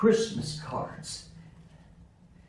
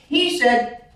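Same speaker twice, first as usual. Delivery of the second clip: loud, raised, vehement retelling; heated outburst; 6 semitones higher, 10 dB louder than usual. Acted. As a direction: build to a loud, emphatic curse. Retelling Patton's furious vow about Hitler.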